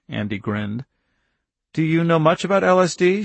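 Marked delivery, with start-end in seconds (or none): 1.7-3.2 put-on light teasing young woman; grinning girl voice. A narrator's reading. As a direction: ask with a sly little grin. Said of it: "with a little corner-wise grin".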